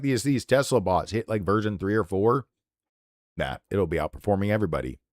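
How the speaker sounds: ordinary delivery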